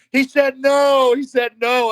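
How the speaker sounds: high, loud, drawn-out announcing; mock official holler; imitated referee call. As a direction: call it out over the match like a referee. Acts referee calling out answer.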